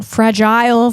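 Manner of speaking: fragile said as FRA-jile, long i; deliberate mispronunciation. Shown as a demonstration. Vlogger playing with the word fragile, deliberate mispronunciation. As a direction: make the end of fragile rhyme with mile. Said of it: "and that's fragile".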